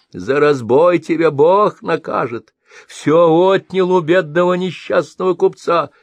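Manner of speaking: put-on high, loud indignant old trader; threatening merchant. A narrator's reading. Merchant wags finger at robber, railing. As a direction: shake a finger and rail.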